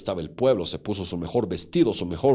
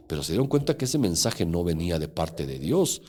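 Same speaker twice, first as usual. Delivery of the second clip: ordinary delivery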